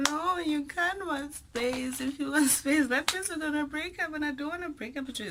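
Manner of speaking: high, desperate mock plea; high pleading. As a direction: beg him in a squeaky hammed-up voice. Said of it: "And I'm like".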